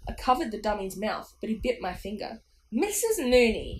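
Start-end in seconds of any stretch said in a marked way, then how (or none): 2.7-3.8 loud taunting dummy call; Slappy's shriek. A narrator's reading. Dummy calling out to Mum, shrieked. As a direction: shriek the name in a puppet voice. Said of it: "he shrieked at my mum".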